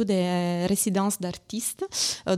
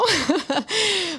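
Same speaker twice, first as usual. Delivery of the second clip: embarrassed laugh; 11 semitones higher, 3 dB louder than usual. Real, not acted. Laughing at her own accent.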